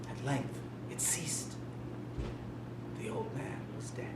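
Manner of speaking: breathy, toneless whisper; eerie whisper. A narrator's reading. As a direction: hush it as if the room might hear. Hushed after the killing.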